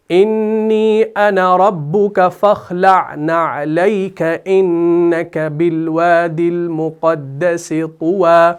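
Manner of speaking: slow, drawn-out melodic tilawat; Quran recitation. Shown as a demonstration. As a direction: chant each Arabic word long and measured. Recites Quran verse slowly.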